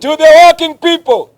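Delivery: high booming rally shout; booming ideological slogans. Real, not acted. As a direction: call out to the crowd at full force. Call and response slogans.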